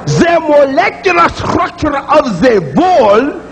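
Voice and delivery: slow mock Russian with vall; a hint of a Russian accent; light Russian accent. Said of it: "he'll give you a labial dental fricative".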